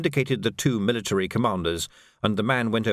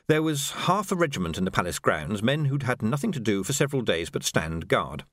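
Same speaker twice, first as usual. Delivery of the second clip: ordinary delivery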